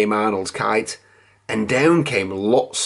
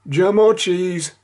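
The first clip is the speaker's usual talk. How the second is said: Kipper; 6 semitones higher than usual. A narrator's reading. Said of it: "said Kipper".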